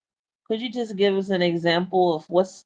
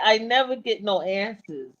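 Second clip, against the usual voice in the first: ordinary talk, nothing marked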